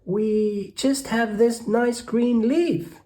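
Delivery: very high, slow squeaky ant voice; tiny ants. Acted. Ants answering the rat, high and squeaky. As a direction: peep it out like frightened little ants. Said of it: "the ants replied".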